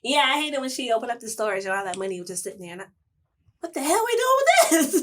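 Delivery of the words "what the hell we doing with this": exasperated outburst. Retold or imitated. Annoyed reaction to a TV scene.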